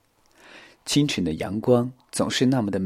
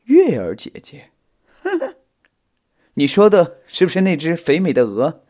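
scoffing laugh, loud contemptuous question; disdainful; 7 semitones higher, 7 dB louder than usual. A narrator's reading. Spider dismissing the youngsters' grief, disdainful. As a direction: sneer with a dismissive chuckle. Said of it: "大蜘蛛不屑一顾地说".

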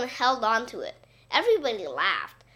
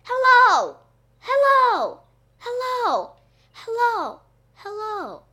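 high shout fading with each repeat; yelled echo; 12 semitones higher, 6 dB louder, slower than usual. A narrator's reading. Gym yells back at the boy, fading echo. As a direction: holler like an echo, loud then dying away. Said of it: "The gym yelled back".

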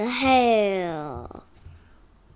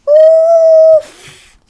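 high effort grunt; 18 dB louder than usual. Acted.

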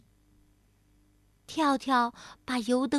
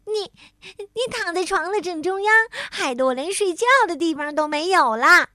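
higher, aggrieved complaint; indignant; 6 semitones higher, 5 dB louder than usual. A narrator's reading. Tiaotiao blames bed-hogging Bengbeng, indignant. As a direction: protest at being crowded out. Acted quarrel over the bed.

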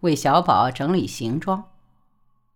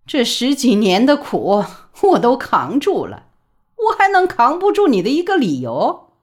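scornful, rising to a high louder challenge; cold sneer; 7 semitones higher, 5 dB louder than usual. A narrator's reading. Mother scoffing at son's secret reason, cold sneer. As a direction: sneer at his threat. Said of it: "李宝莉冷笑道".

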